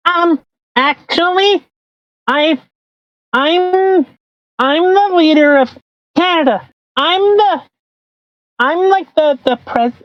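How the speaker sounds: put-on squeaky little boy Trudeau; Trudeau impression. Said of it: "okay, I'm done with this".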